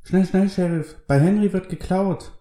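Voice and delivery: put-on high-pitched, urgent young boy; high, hurried child's voice; excited boy. A narrator's reading. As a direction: raise the alarm like a boy fetching the sheriff. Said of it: "Der Junge tippelte vor Marty".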